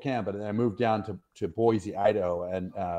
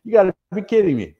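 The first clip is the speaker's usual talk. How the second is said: disbelief; 11 semitones higher, 6 dB louder than usual. Retold or imitated. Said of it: "I was like".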